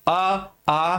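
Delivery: अ and आ voiced with open breath flowing out; vowel demo. Shown as a demonstration. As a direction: let the air flow out on अ, आ. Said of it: "वो कुछ ना कुछ मात्रा में बाहर निकलती है".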